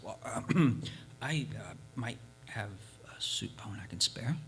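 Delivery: low, hushed, grudging admission; reluctant whisper. Acted. Village leader grudgingly whispers that he has a soup bone. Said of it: "the village leader spoke up".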